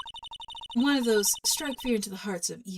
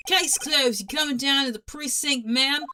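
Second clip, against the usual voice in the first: put-on New Jersey accent; 5 semitones higher, 5 dB louder than usual. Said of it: "I can't do a Jersey accent".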